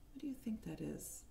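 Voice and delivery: hushed secretive question; whispering. Real, not acted.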